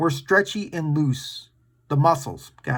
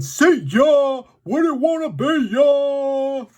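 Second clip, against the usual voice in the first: high, loud taunting dinosaur voice; slow teasing victory taunt; cocky stegosaurus; 8 semitones higher, 4 dB louder, slower than usual. Pretend. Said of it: "perked up its spiky tail and said".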